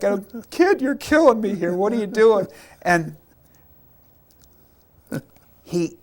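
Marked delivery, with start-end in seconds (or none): 0.5-2.5 higher and louder, fondly exasperated; mock exasperation. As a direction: pretend the kid is breaking you. Soldier's exasperated reply.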